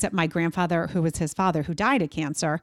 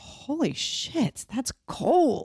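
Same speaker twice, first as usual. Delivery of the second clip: shocked reaction; 7 semitones higher, much slower than usual. Retold or imitated. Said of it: "And I was like".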